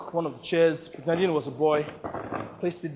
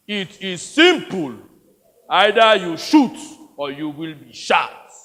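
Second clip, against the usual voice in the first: loud, slow opening threat; menacing soldier; 9 dB louder, slower than usual. Acted. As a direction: lay down a brutal ultimatum.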